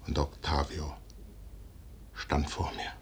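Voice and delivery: whispered, awed low line; soft whisper of the vision; hushed whisper. Acted. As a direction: breathe it out in awe. Hushed dream recollection.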